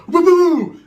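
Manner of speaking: high, loud mock whoop; hammed-up woo-hoo. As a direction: whoop like the door just blew open. Door bursts open, exclaiming.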